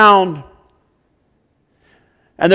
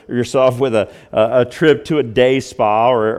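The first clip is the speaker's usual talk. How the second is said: normal talking voice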